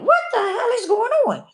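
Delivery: high-pitched bewildered question; puzzled alarm, voice shooting up; startled disbelief. Retold or imitated. Asking herself why she keeps nodding off, high and alarmed. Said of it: "I said".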